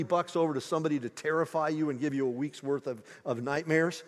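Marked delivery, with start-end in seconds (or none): none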